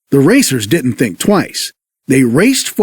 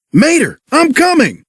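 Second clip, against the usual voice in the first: higher call across the forest; yelled; 10 semitones higher than usual. Acted. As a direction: shout to reassure a friend. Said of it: "he yelled".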